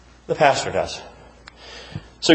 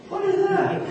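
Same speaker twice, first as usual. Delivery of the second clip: mock puzzled parishioner; 4 dB quieter than usual.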